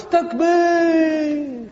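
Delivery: high, stretched-out shouted call; rallying shout. Retold or imitated. Guy calling takbir to a lazy crowd, shouted. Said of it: "so the guy goes".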